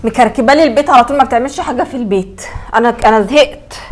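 put-on loud, exasperated rant about the girl; fed-up complaining. Stepmother loudly complaining to her husband about the girl, exasperated. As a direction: vent your frustration loudly.

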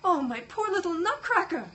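high, loud pained cry for the toy. Acted. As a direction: wail over the broken doll. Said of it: "Marie cried".

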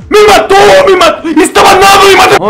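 loud high cries of defeat; shocked yelling. Real, not acted. A gamer screaming at the screen after losing. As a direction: yell it out as he gets knocked out.

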